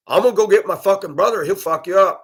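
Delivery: loud, fast furious threat; threatening shout. Retold or imitated. Angry woman threatening the driver, fast loud threat. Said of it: "I said".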